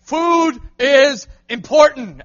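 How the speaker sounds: slow loud drawn-out shout; loud slow call-back; congregation shout. Shown as a demonstration. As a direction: belt out every word. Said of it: "How many of you say".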